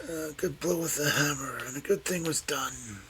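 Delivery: put-on distinct, deliberate old man's line; slowly and distinctly. A narrator's reading. Old man muttering in sleep to nobody, slow and distinct. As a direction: spell it out as if answering. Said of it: "slowly, and distinctly".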